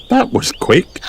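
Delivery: high, lightly surprised mother voice; high storybook mum; Mummy Pig. A narrator's reading. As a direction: remark on it, pitched well up. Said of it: "said Mummy Pig".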